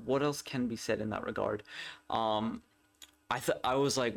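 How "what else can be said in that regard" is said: ordinary talk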